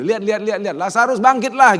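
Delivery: high, loud look-at-me boasting; loud smug demand; arrogant showing off. Pretend. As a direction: call out proudly to the crowd. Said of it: "sombongnya saya kali gitu".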